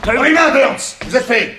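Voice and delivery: very high, loud order barked; commanding shout. Acted. Detective Carter catching the killer in the act, commanding shout. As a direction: freeze him with a shouted command.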